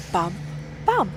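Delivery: ordinary delivery, nothing marked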